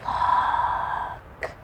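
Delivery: whispered look; hushed look. Acted. Badger to the animals, whispering at the nut. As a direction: breathe it in awe.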